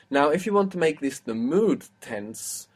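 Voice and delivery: ordinary talk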